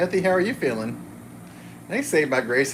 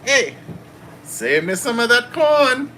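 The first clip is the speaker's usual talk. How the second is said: high, loud, slow silly voice; movie quote on request; 8 semitones higher, 6 dB louder, slower than usual. Pretend. Wife asks him to say the shirt's movie line, high and hammy. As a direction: ham up the catchphrase. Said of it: "[name] has to say it".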